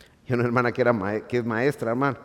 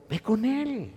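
climbing higher and louder into a fervent plea; rising urgent exhortation; 11 semitones higher, 6 dB louder, slower than usual. Acted. Preacher urging the flock to unload their problems, rising fervour. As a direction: push it up, pleading with the congregation.

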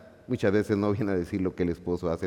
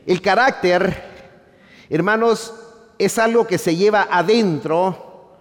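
louder, higher preaching cadence; full-force preaching; 9 semitones higher, 8 dB louder than usual. Acted. Preacher to congregation, full-force preaching. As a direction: preach it out over the pews.

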